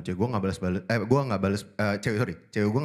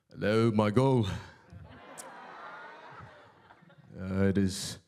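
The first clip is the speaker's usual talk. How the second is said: low, slow hammy Thor; 3 semitones lower, 4 dB quieter, slower than usual. Pretend. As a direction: ham up the god of thunder, slow and deep. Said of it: "gue pakai suara Thor". (suara Thor yang lambat dan berat)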